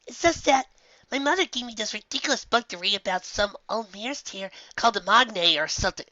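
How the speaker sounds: a bookish filly grumbling to her mentor; young filly voice. Acted. Filly voiced by reader, higher.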